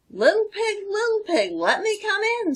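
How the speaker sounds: high, sweet, sing-song wolf voice; fake-polite wolf. Wolf sweetly coaxes Stella to open up, high and sing-song. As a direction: lure the pig in with sugary politeness. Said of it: "pretending to be polite".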